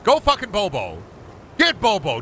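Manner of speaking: high-pitched egging-on call; playful yelling. Pretend. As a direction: holler it as a cheer. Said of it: "I'm just yelling".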